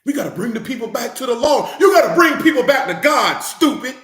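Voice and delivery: loud, high, scolding shout; fed-up shouting at preachers; heated rebuke. Real, not acted. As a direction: call them out hard. Angry rebuke of law preachers.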